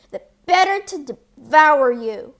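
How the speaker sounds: loud, booming wolf threat; the wolf lunging at his prey; menacing wolf roar. A narrator's reading. Wolf pounces.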